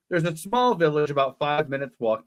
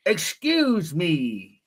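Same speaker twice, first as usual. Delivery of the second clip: slow drawn-out line; much slower than usual. Acted. Reading character line slowly.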